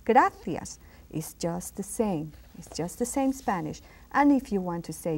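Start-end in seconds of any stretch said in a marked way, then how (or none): 0.0-0.8 "Gracias" with a Castilian TH for the C; punched "Gracias" with TH in place of S; TH sound in gracias. Shown as a demonstration. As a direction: model "Gracias" as GRA-thyas. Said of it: "That C can be pronounced as a T H sound".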